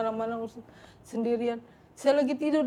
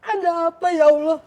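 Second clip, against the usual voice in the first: high, much louder tearful cries; loud tearful high prayer; sobbing prayer; 7 semitones higher, 8 dB louder than usual. Retold or imitated. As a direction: sob out the prayer to God. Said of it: "Kenapa ini nangis?".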